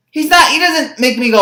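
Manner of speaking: normal talking voice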